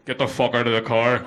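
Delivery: low, loud threatening order; menacing Northern Irish heavy. Acted. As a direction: order them out like an armed thug. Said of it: "We're the other guys".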